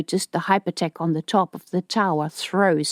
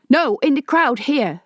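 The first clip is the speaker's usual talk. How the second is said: yelling into com; 7 semitones higher than usual. Acted. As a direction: make yourself heard over the chaos. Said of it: "Elko was yelling into his pocket com".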